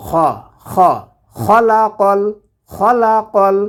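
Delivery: খলাকাল with খ from the top of the throat; throat kha. Shown as a demonstration. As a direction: rasp the খ from the upper throat. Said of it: "কণ্ঠনালীর শেষ ভাগ হতে উচ্চারণ করব". (খলাকাল শব্দে খ কণ্ঠনালীর শেষ ভাগ থেকে)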